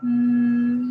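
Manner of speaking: loud held mm; demo m sound. Shown as a demonstration. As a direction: hum the m firmly, lips closed. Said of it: "look at my mouth".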